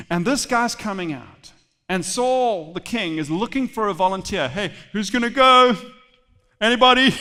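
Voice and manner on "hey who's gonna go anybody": loud, projected call to a crowd; calling for volunteers. Acted. Preacher plays king seeking a volunteer, calling out. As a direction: call out hopefully across the ranks.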